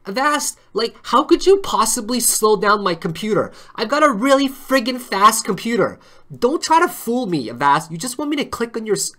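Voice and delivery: loud, high mock rant at Avast; hammed-up tirade against the antivirus. Ranting at Avast, hammed-up fury. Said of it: "I got carried away".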